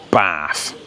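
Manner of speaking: "bath" with a short, front "a" as in "cat". Shown as a demonstration. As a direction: say "bath" with a flat northern short A. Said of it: "uses lots of different phonetics".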